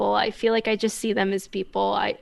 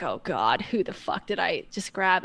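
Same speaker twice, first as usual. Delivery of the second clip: hushed dismay; 3 semitones lower, 6 dB quieter than usual. Retold or imitated. Retold inner panic.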